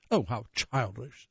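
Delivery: slow, haughty lady dog voice; snooty poodle voice. Acted. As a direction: drawl it out with disdain. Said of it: "says the poodle".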